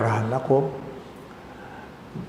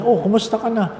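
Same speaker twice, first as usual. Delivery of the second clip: cheery doctor; 9 semitones higher, faster than usual. Retold or imitated. Doctor's cheery greeting quoted.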